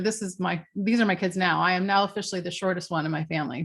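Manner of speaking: ordinary delivery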